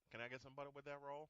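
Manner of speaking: low, quiet aside in a commentator's voice; quick, soft, low-toned impression of a broadcaster; Stacey King. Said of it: "Stacey King in a low tone".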